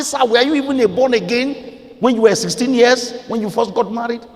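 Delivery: high, incredulous retort; indignant challenge. Retold or imitated. Preacher retells challenging a big minister, indignantly.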